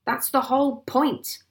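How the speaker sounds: high, loud angry outburst; indignant yell; exasperated cry. A narrator's reading. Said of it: "cried Mister Hazel".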